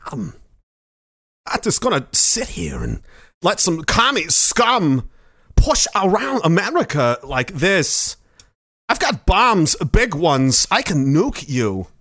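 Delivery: slow, halting Walken voice as an unhinged president; a Cold War president threatening nukes; Walken as Reagan. Pretend. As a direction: menace the commies with odd, drawn-out pauses. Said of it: "Walken plays Ronald Reagan".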